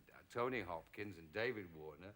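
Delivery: usual speaking voice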